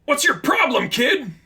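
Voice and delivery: very high, loud angry challenge. Acted. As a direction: snap at the kid. Figment confronting attacker.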